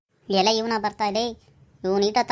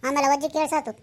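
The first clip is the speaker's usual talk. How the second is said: ordinary delivery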